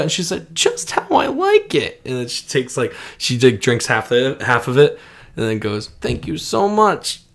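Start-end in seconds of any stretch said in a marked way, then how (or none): 0.5-2.0 very high, drawn-out grandma voice; pleased grandma. Retold or imitated. Acts out grandmother pleased with drink. 6.0-7.2 high, slow grandma thanks; grateful grandma. Retold or imitated.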